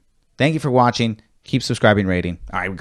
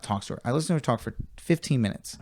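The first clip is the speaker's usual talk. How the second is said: normal talking voice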